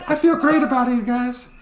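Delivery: a squeaky cartoon politician; impression of Roger Stone. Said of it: "I have no idea what he sounds like".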